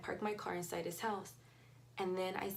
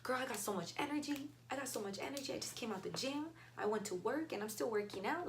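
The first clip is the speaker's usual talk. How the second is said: hyped inner voice; 6 semitones higher than usual. Retold or imitated. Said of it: "the other part of me is like".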